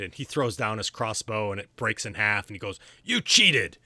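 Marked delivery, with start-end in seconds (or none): 3.0-3.8 high, loud shout of a sore loser; furious accusation. Acted. Todd shouting at the archer who beat him, furious. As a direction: yell it at the winner, outraged. Todd loses, breaks his crossbow.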